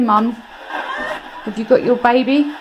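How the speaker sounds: ordinary talk, nothing marked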